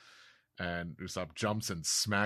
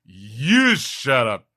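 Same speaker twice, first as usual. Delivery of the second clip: much louder, slow shut up; angry retort; 5 semitones higher, 13 dB louder, slower than usual. Acted. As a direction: shout it back at him. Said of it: "And he says".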